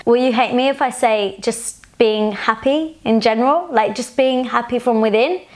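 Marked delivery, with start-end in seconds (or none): none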